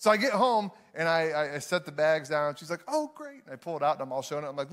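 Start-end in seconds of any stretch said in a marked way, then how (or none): 2.9-3.4 high, lightly voiced wife's reply; higher-pitched retold delight; pleased wife.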